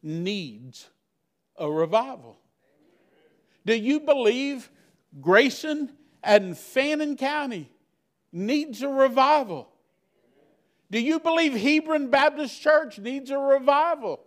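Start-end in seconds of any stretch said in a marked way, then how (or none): 3.6-14.2 higher and higher, pressing the question home; rising preacher's appeal. Real, not acted. Preacher pressing the church, climbing higher and higher. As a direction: climb in pitch with each place named.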